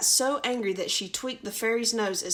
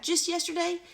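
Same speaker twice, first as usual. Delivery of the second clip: cat's voice; 9 semitones higher than usual. Acted. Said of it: "meowed the cat".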